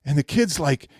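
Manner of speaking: ordinary delivery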